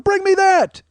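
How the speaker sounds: high, loud call across a restaurant; yelled across the room. Retold or imitated. Retelling yelling an order across the room, loud high call. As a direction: shout the order over the room. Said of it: "I just yelled it from across the room".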